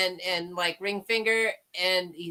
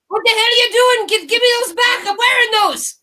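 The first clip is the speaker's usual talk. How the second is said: high, loud, fast outburst; indignant mock yelling; 11 semitones higher, 9 dB louder, faster than usual. Friend voicing Marty catching panty raiders, indignant yelling. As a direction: yell it in mock outrage, pitched way up.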